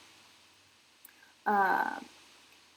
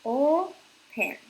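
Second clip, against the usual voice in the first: "open" broken in two, O louder and higher; split into syllables; 5 semitones higher, 6 dB louder than usual. Shown as a demonstration. Teacher dividing a verb for students, "open" split into syllables. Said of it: "Lo dividimos en sílabas".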